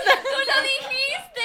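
high, slow, laughing cry-shout; laughing shriek. Real, not acted. Accusing her friend of saying it, laughing shriek.

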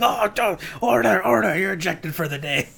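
high, loud hammed-up call for order; mock Speaker ejecting. Speaker ejecting the MP, high loud mock bellow. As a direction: throw him out like a pompous Speaker. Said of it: "they're like".

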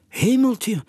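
high, startled little cry; startled exclamation. Acted. Owl Jodocus startled by a shadow, high exclamation. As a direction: gasp it out in surprise. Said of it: "riep Jodocus de uil uit".

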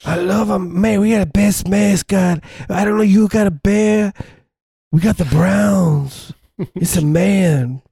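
high Cleveland fan voice; Cleveland accent. Acted. Browns fan boasting about the mascot, high Cleveland accent.

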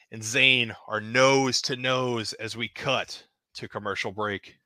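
ordinary delivery

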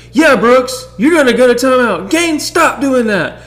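mock wife voice, high and loud; imitating his wife. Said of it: "And she goes".

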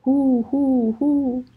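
high, loud hunting call. Acted. Hunter calling his dogs from the hilltop, loud call. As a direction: call the hounds across the hills.

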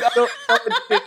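high, giddy laughing retelling; helpless laugh through the words; nervous laughter. Retold or imitated. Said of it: "nervous laughter".

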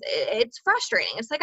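ordinary talk, nothing marked

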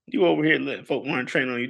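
usual speaking voice

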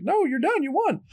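high, elated coach voice; excited coach. Retold or imitated. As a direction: burst out with the good news. Coach announces win.

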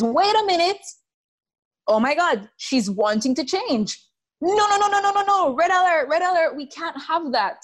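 high, frantic alarm voice; panicked inner voice. Acted. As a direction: sound the red alert in panic. Subconscious mind voiced in panic.

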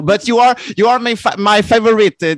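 loud, high, gushing; excited praise. Real, not acted. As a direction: burst out with delight. Warmly thanks host, very loudly.

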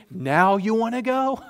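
high, loud mock disbelief; incredulous disciples. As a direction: play a fed-up disciple. Disciples' exasperation at Jesus.

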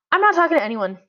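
usual speaking voice

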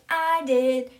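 loud, proud little admission; retold pupil's boast. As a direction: own up boastfully, acting a pleased schoolboy. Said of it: "He's super proud about it".

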